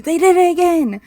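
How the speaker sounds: loud, high-pitched cry; exclaiming outburst. Real, not acted. Player interrupts the story to protest, high exclaiming outburst.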